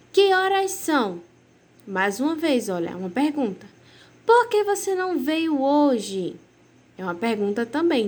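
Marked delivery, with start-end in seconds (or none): 0.1-1.2 a slow, higher real question. Shown as a demonstration. Teacher showing pupils how to read a question, slow and asking. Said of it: "Eu estou fazendo a pergunta". 4.2-6.3 slow, higher asking tone; question tone. Shown as a demonstration. Teacher modelling a direct question for pupils, slow and asking. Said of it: "ler com um tom de pergunta".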